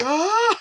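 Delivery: long rising wail, getting loud; mock freak-out cry. Host imagining dad finding the door broken, mock freak-out. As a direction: let a stretched "ah" climb high in comic panic. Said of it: "trying not to freak out".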